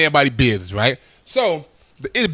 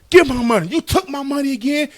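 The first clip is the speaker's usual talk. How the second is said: raised, higher voice demanding his money back; angry demand; 8 semitones higher than usual. Retold or imitated. Uncle bursting in demanding his money back, angry. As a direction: bark it like a shakedown.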